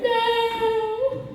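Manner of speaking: high, thin hammed-up wail; comic tearful squeal, far above normal pitch; mock crying. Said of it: "I'm just crying over here going".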